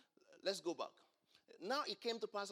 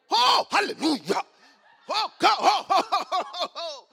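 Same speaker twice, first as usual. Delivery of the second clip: mock hypocrite shouting, much louder and higher; showy loud prayer; 13 semitones higher, 20 dB louder than usual. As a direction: ham up a loud prayer so everyone hears. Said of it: "Then you ramp it up so that others can hear".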